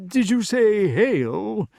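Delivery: high, slow, wary question; worried king's high-pitched inquiry; nervous. A narrator's reading. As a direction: query it high and uneasily, drawing it out. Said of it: "looking about him nervously".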